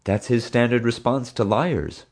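ordinary delivery

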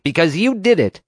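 high, loud snap; snapping. A narrator's reading. Marge snapping back at the boy, angry. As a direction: bite the words off hard. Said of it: "Marge snapped".

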